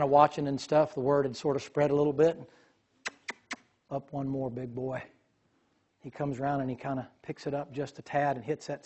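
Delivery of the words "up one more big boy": soft command. Retold or imitated. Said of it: "He said".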